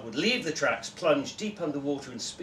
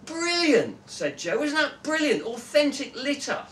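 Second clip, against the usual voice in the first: high-pitched delighted exclaim; 12 semitones higher, 5 dB louder than usual. Acted. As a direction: gush with glee over old litter. Joe delighted.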